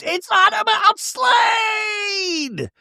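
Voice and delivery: shrill high scream, dragged out slow; mock scream of a reality star losing it. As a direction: scream it like you've lost control. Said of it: "Gretchen finally screams".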